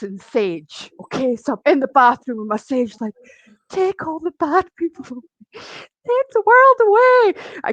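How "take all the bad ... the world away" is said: dramatic pleading. Retold or imitated. Alone in the bath with sage, dramatic pleading.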